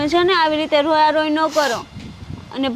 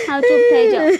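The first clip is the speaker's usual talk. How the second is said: louder, firm plea to stop; firm hush; 6 semitones higher, 5 dB louder than usual. Acted. Daughter firmly hushing her crying mother, louder. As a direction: cut through her crying.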